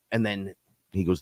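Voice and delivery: ordinary delivery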